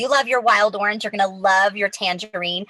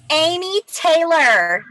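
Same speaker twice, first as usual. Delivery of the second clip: higher winner call; hyped giveaway announcement; 4 semitones higher than usual. Real, not acted.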